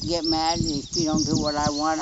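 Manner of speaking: ordinary talk, nothing marked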